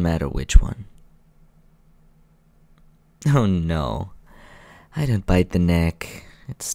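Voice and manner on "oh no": ordinary talk, nothing marked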